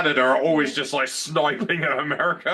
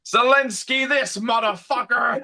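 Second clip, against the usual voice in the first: high, slow sneering put-on voice; hammed-up high sneer; mock villain; 9 semitones higher, slower than usual. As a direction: spit the name out in character. Joking villain voice continues.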